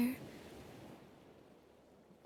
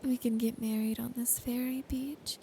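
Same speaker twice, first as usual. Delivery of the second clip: whisper. Acted. Girlfriend promising her partner trust and marriage, whispered. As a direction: close intimate ASMR whisper throughout.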